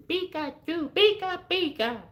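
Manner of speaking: very high little creature voice; Pikachu. Acted. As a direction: chirp it in squeaky falsetto, like a cartoon monster's call. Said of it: "that's all that is".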